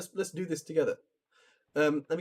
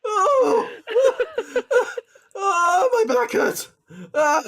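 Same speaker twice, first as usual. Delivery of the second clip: breathless laugh-crying; 9 dB louder, much slower than usual. Real, not acted. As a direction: squeeze it out through laughter. Laughing fit after the reading.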